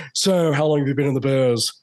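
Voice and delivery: drawling movie-star small talk; Nicolas Cage. Retold or imitated. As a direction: imitate an eccentric star quizzing a newcomer. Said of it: "You know, he goes".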